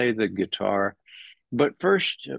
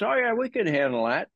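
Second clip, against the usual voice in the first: mock cocky advisor; 11 semitones higher, faster than usual. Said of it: "his advisors".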